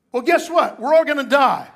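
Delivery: loud high punch; emphatic. Real, not acted. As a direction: hit it hard. Punchline delivered forcefully.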